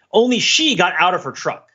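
normal talking voice

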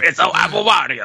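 hammed-up game character catchphrase; Wario. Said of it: "The accents are Wario".

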